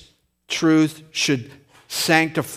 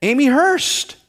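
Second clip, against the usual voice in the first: a shocked, much higher "Who?"; surprised high voice; 12 semitones higher, 3 dB louder than usual. Retold or imitated. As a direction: squeak up high in disbelief.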